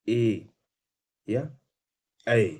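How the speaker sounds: ए and ऐ sounded out loud and held; vowel demo. Shown as a demonstration. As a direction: model ए then ऐ for the class. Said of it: "आप जैसे बोलिए". (ए और ऐ को स्पष्ट, खींचकर बोलें)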